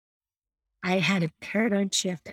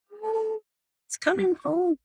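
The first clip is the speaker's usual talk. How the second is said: squeaky high-pitched panic, much higher; a sudden high squeal of alarm; high mock dread; 12 semitones higher than usual. Mother spotting her son, a high squeaky mock panic.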